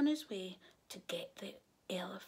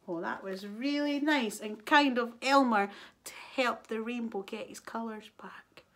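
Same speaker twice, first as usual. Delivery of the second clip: ordinary delivery, nothing marked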